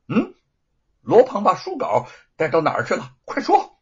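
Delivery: loud, high, stern interrogation; sharp demand. Acted. Yu Feng interrogates the thief, stern and sharp. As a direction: snap the order to talk now. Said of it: "玉凤厉声地问".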